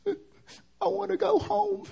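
high, slow, sobbing little sister; crying. Retold or imitated. Brother imitating his crying sister on the phone, high and sobbing slowly. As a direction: wail it through tears. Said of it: "she's crying".

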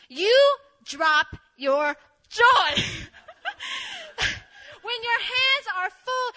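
slow, loud preacher cadence, hammed-up; mock sermon. Spinning her story into a joking sermon, slow preacher delivery. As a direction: preach it like a pastor. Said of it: "that sermon analogy".

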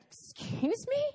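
incredulous high squeak; shocked disbelief. Retold or imitated. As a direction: sound floored by the news. Stunned reply to the clerk.

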